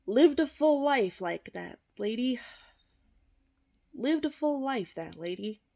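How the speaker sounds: hammed-up reverence; mock eulogy. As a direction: ham up the solemn praise. Mock solemn tribute.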